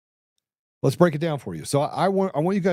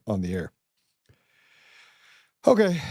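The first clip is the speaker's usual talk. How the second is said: ordinary talk, nothing marked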